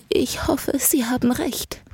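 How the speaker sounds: put-on high, half-whispered old lady voice; hope aloud, frail and fearful; anxious, breath-thin elderly voice, pitched high; brittle, frightened old woman. A narrator's reading. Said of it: "Ihre Stimme war so brüchig ... völlig verängstigt".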